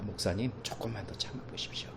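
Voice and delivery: soft whispered encouragement; prayer partners whisper gentle comfort. Retold or imitated. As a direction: whisper it kindly to the pastor. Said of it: "함께 기도해 주시는 분들은 얘기하십니다".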